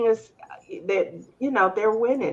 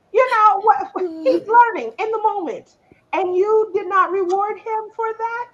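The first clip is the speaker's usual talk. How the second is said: higher, louder incredulous protest; indignant; 8 semitones higher, 4 dB louder than usual. Real, not acted. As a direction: protest in disbelief. Indignant about teacher.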